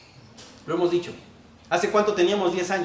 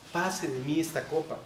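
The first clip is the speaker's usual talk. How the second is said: soft, lower pleading words; humble plea; 3 semitones lower, 8 dB quieter than usual. Shown as a demonstration. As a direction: ask humbly, almost softly. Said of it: "Es un ruego". (ruego humilde y suave)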